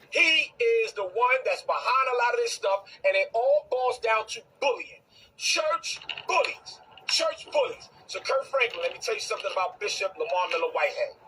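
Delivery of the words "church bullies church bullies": slow and higher, leaning on each word; stressed slow phrase. Real, not acted. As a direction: spell it out with scorn. Repeated emphatic phrase.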